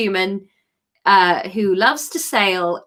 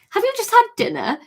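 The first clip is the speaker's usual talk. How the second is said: quick blurted question; 12 semitones higher, faster than usual. Acted. Kate blurts question in story.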